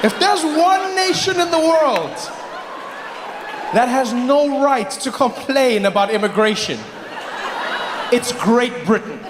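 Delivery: high, slow, drawn-out declaration; exasperated comic proclamation; emphatic indignation. Acted. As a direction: spell out the outrage slowly, pitched high. Comic tirade at Great Britain.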